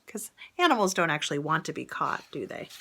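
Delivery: ordinary delivery, nothing marked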